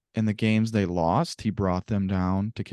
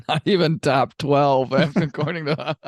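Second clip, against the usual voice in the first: laughing; 6 semitones higher, 4 dB louder than usual. Real, not acted. Host laughs at co-host's jab.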